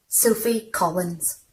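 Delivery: ordinary delivery, nothing marked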